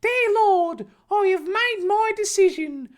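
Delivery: very high, loud mock-solemn proclamation; bellowing. Harry praying to the ceiling, bellowed high-pitched declaration. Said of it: "he bellowed".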